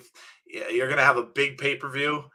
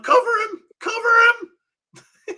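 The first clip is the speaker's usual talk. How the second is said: high yell imitating a ringside announcer; hammed-up excited yell, ending in a laugh; [name]; 7 dB louder than usual. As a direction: mimic a frantic commentator's high-pitched yelling. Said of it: "[name] yelling".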